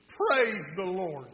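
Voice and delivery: drawn-out, loud call; loud praise. Acted. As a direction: close the prayer with a slow holler. Lady closes her porch prayer.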